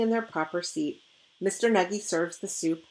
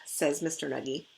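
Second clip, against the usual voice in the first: usual speaking voice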